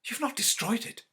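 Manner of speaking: high, loud urgent question; anxious. Acted. Wife asks her husband in a high, anxious voice. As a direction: dread that it is gone.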